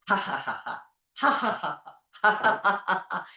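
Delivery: forced ha-ha bursts, rising; repeated deliberate laugh bursts; laughing sound. Shown as a demonstration. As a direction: laugh like a push-started engine. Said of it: "a push start with your foot".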